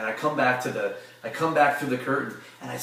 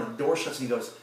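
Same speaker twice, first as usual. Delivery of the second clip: ordinary delivery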